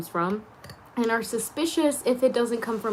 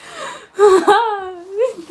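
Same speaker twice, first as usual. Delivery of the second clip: high burst of laughter; 11 semitones higher, 8 dB louder than usual. Real, not acted. Laughs at herself alone.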